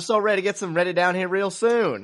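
fake Southern accent. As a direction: mimic a coach faking a Southern accent. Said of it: "job than Brian Kelly doing his".

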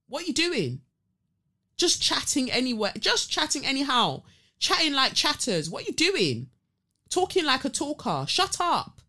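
high-pitched, indignant ranting; scolding that climbs to a high "shut up"; exasperated scolding. Acted. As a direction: tell off the chatterers in disbelief. Mock-addressing the chattering couple.

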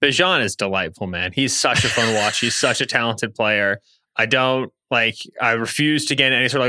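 normal talking voice

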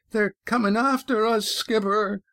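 very high, panicked cry without extra volume; frightened, pitched far up throughout; almost a scream. A narrator's reading. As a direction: squeal the warning to the skipper. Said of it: "Yan's voice was almost a scream".

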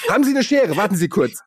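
high, laughing request; flustered. Retold or imitated. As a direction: ask the robber to wait. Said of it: "Und ich krieg sie".

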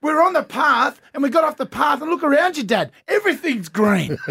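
put-on high, indignant kid's voice, slowing at the end; imitating daughter. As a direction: argue like a puzzled child. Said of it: "she said".

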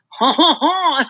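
put-on a theatrical French-accent chuckle; French-accented laugh. Actress recalling catching herself in character, mock French laugh. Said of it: "when you start talking in a French accent".

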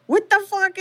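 shocked son. Retold or imitated. Son shocked by father's news.

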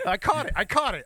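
laughing it out very high; giggling with relief. Real, not acted. Telling his co-host about his back, laughing with relief.

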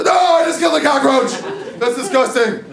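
high, startled yelp of disgust. Retold or imitated. Barefoot in the dark, yelping at a crushed bug. Panicked yell stepping on cockroach.

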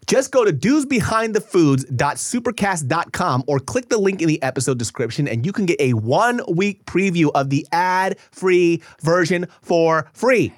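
host reading the sponsor spot in a loud ad voice. Acted. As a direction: pitch the ad-free version like an announcer. Reads the podcast ad.

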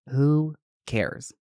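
"Who cares" stretched out with a gap between the words; deliberate, spaced "Who cares"; drawn-out dismissal. Real, not acted. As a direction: draw out "Who cares" and let it fall. Dismissing fears, said twice.

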